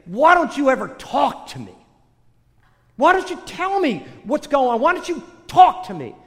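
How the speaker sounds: high, loud demand to God; angry. Acted. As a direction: fling the questions out in frustration. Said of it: "'Cause I was angry".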